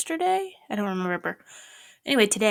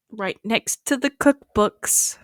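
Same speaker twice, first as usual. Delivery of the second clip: slow emphatic; 5 dB louder, much slower than usual. Real, not acted. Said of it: "repeated in whisper".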